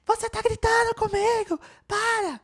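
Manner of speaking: high, very loud angry shout; acted outburst, screamed high and loud; reactive yelling. Shown as a demonstration. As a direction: explode at them, shouting at the top of your voice. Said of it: "Você não vai falar: ... Isso é reatividade".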